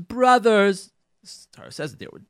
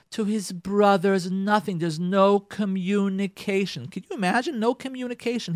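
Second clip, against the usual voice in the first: normal talking voice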